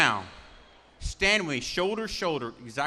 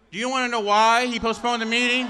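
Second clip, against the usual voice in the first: louder and higher, baiting the crowd; raised teasing question; 5 semitones higher, 8 dB louder than usual. Acted. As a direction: whip the crowd up with it. Rally speaker teasing the crowd.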